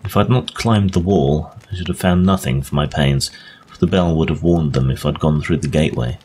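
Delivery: ordinary delivery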